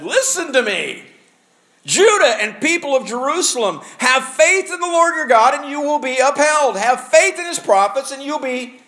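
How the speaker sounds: rally the people like a king; proclaiming king. Acted. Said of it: "Jehoshaphat stood and said".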